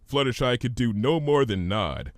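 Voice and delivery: plain reading voice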